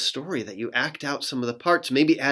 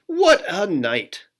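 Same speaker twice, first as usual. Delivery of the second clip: louder, slow grumbling mole; mole muttering; 5 semitones higher, 5 dB louder, slower than usual. Acted. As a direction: mutter it like a grouchy little mole. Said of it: "Mole mutters".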